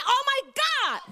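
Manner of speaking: preacher leading the congregation to repeat an awed phrase; prompted exclamation. Shown as a demonstration. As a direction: lead the church in the cry. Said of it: "Can you say".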